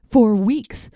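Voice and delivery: ordinary delivery, nothing marked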